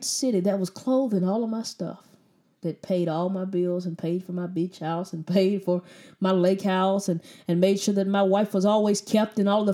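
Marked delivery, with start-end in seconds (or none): none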